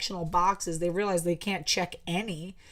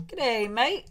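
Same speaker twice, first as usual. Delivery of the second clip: a light try at an Australian accent; casual Australian greeting, lightly put on; 5 semitones higher than usual. Shown as a demonstration. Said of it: "I'm working on my little Australian accent".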